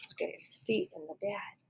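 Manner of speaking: hushed whispered aside. Real, not acted.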